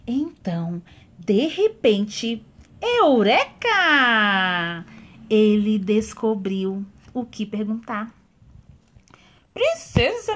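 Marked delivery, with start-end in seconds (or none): 2.8-4.8 loud, long drawn-out eureka. A narrator's reading. Sudden idea, stretched out. 9.5-10.3 high, loud call to the princess. Acted. Young man calls princess.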